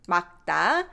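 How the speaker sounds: 맑다 said as "막따", only the ㄱ of the ㄺ sounded; teacher models 맑다 with the ㄹ silent and the ㄱ kept; pronunciation demo. Shown as a demonstration. Korean teacher to stream viewers, modelling 맑다 as "막따". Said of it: "we just pronounce this 기역 underneath".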